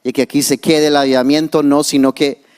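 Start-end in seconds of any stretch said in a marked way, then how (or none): none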